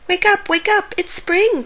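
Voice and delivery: high, excited storybook cheer; excited dog's wake-up cheer. A narrator's reading. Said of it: "cheered the dog".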